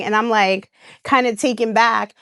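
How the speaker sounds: ordinary delivery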